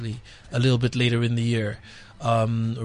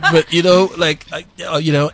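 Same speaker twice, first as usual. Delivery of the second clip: laughing; 4 semitones higher, 9 dB louder than usual. Retold or imitated. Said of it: "And I was like".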